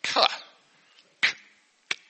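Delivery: hard K sound said alone. Shown as a demonstration. Teacher sounding out the silent K for kids. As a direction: click out the K sound on its own. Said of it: "So we don't actually say the K".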